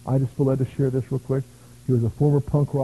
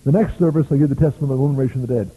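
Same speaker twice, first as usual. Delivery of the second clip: usual speaking voice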